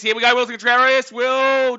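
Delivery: high, loud commentator's shout; sudden real hollered excitement; excited play call. Real, not acted. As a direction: yell the batter on as the ball flies. Calling a hit as it happens.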